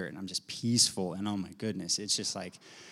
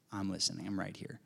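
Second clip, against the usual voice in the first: no emotion; 2 semitones lower, 12 dB quieter than usual. Retold or imitated. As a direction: say it softly, with nothing in it. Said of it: "Like, no emotion, nothing".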